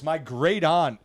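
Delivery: normal talking voice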